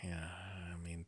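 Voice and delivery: hushed aside whisper. Real, not acted. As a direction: drop to a whisper. Quiet aside under breath.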